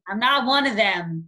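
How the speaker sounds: ordinary talk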